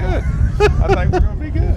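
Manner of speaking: high, slow sing-song repeat. Pretend. Repeats line, second sung high.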